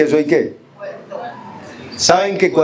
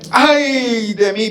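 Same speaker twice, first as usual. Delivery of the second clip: drawn-out lament; 6 semitones higher, much slower than usual. Acted. Isaiah's lament read aloud.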